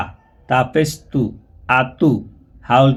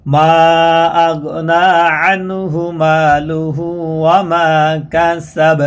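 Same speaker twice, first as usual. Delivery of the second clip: slow, chanted Quranic recitation, higher; 3 semitones higher, much slower than usual. Shown as a demonstration. Teacher recites the verse for students, slow and chanted. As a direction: recite the verse slowly and melodically. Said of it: "তাহলে কাসাব".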